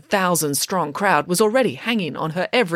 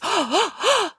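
several quick audible gasps; shocked gasps. A narrator's reading. Crowd sees Finn unmasked, shocked gasps. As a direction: gasp repeatedly in shock. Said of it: "There were more gasps".

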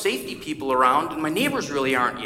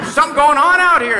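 high, loud call across the yard; yelling to wife; 10 semitones higher, 9 dB louder than usual. Retold or imitated. Pastor calls his wife from the porch, loud retold yell. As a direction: shout it out to the house, mock urgent. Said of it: "I yelled to my wife".

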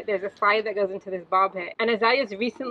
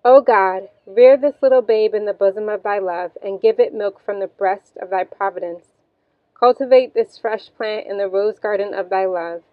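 louder, solemn second reading; prayer read again; 7 dB louder than usual. Real, not acted. Mother rereading the infant prayer, solemn and louder. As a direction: repeat the prayer with the same devotion. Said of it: "And again".

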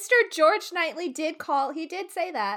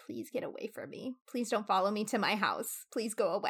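much softer, lower pleading to be left alone; pleading quietly; 6 semitones lower, 8 dB quieter than usual. Retold or imitated. A woman quietly begs a pushy man to leave. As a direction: quietly beg him to go away. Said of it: "you're just like".

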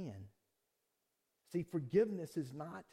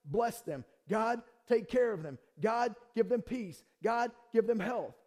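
forced prayer; 6 semitones higher, 7 dB louder than usual. Retold or imitated. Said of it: "totally radical for someone to go".

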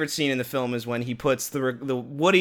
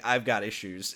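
deadpan mom line; 6 semitones lower, 8 dB quieter than usual. Retold or imitated. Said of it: "my mom saying".

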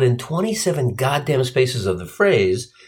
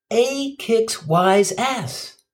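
usual speaking voice

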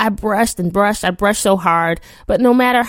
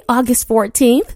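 ordinary delivery

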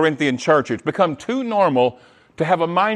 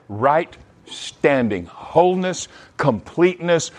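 normal talking voice

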